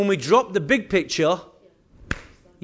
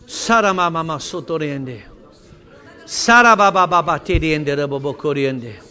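low, rolling run of chanted syllables; praying in tongues; 3 semitones lower, 4 dB louder than usual. Real, not acted. Pastor praying in tongues over the congregation, low rolling prayer. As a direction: start each phrase strongly, then let it tail off.

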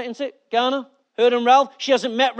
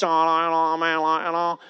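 put-on lower, lazy Manchester kid accent; Manchester youth slang; 6 semitones lower, 3 dB quieter than usual. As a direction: mimic a young Manchester lad, lower. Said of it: "talk to some of the young kids".

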